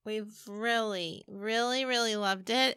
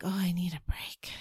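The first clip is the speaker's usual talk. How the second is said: whispered thought; 3 semitones lower, 4 dB quieter than usual. Retold or imitated. Retold inner thought, whispered.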